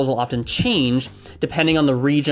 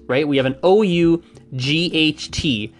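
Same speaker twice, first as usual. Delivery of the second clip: ordinary talk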